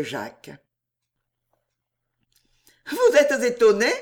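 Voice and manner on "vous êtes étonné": high, loud amused cry; tossing it out through a laugh; laughing exclaim. A narrator's reading. Juliette cries out to Jacques, high and amused. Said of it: "s'écria Juliette en riant toujours".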